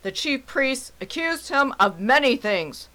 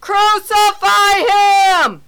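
slow, high, loud shout of a mob; drawn-out furious yell; crowd shouting; 9 semitones higher, 8 dB louder, much slower than usual. Acted. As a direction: bellow it out like an angry crowd. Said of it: "They shouted back".

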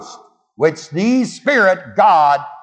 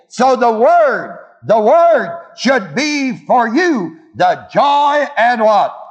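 raised, sustained preacher cadence with call and response; preaching; 6 semitones higher, 3 dB louder than usual. Acted. Preacher calling out to his congregation about the Word. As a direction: preach it, drawing answers from the congregation.

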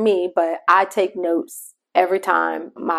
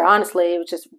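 ordinary delivery

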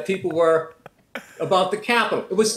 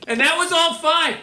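ordinary talk, nothing marked

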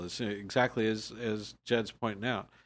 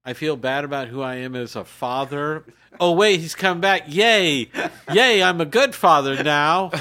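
mock cheering father; 4 semitones higher, 9 dB louder than usual. Mocking the father in the parable.

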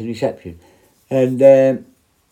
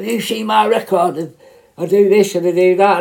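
mock bragging prisoner; 7 semitones higher, 3 dB louder than usual. Prisoner boasting, voiced higher.

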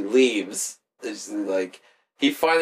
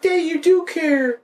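high, slow mock-girl voice; imitated touched daughter; slower than usual. Daughter touched by her father, high imitated gushing.

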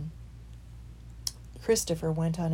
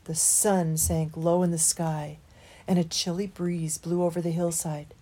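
ordinary delivery

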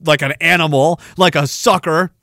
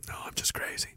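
unvoiced, much softer whisper; 21 dB quieter, faster than usual. Confessing a joke to his audience in a whisper. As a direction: whisper it as a secret aside.

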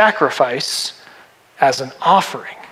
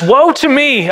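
high dramatic lament; raised, theatrical cry of woe; 8 semitones higher, slower than usual. Acted. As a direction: cry out in mock-grand despair. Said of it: "I cried".

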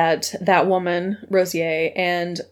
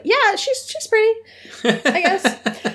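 high goofy voice; 12 semitones higher, slower than usual. Retold or imitated. Jacob voiced shrugging.

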